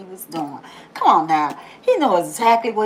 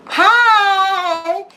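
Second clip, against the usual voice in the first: a bright sudden high greeting; cheery loud hi; 9 semitones higher, 5 dB louder than usual. Pretend. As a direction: call out hello to the watchers. Greeting hidden watchers.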